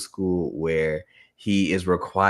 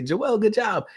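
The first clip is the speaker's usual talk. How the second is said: much higher, cheering praise; excited praise; 13 semitones higher, slower than usual. Retold or imitated. Parent praising little son on the potty, high cheer. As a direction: cheer the toddler on.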